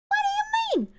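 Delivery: very high cartoon squeak; squeaky disbelief. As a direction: squeak it out in mock shock. Repeats question in squeaky voice.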